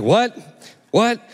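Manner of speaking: high, questioning "what?"; straining to hear. Retold or imitated. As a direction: lean in, puzzled, trying to catch it. Leaning in to hear low speaker.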